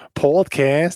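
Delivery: put-on podcast drawn out with an English accent; slow, exaggerated podcast; imitated emphatic pronunciation. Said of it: "énfasis que pones en pronunciar".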